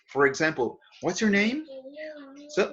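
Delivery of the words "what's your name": "What's your name?" climbing high to the end; rising question tone. Shown as a demonstration. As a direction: let the voice go up on "name". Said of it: "your voice be-, eh, increases".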